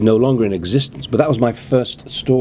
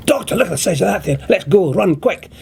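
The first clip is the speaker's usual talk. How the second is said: higher, softened Scottish accent with urgency; the more dramatic Jamie voice; universal Scottish accent; 9 semitones higher than usual. Shown as a demonstration. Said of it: "universal Scottish accent".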